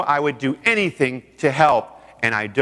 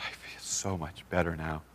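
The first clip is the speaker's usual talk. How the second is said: very soft, low voice; retold quiet relief; 10 semitones lower, 16 dB quieter than usual. Woman calmed down after a crisis call, quiet relief. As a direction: imitate her hushed relief.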